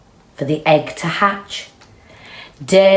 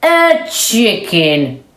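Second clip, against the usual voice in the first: slow knowing; 5 semitones higher, 5 dB louder, slower than usual. Acted. Cow's verdict.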